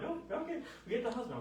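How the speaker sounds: high-pitched, hurried-to-agree placating; retold high-voiced appeasing of a patient; placating reply. Doctor answers demanding patient, high placating voice.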